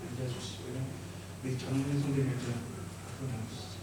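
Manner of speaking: ordinary delivery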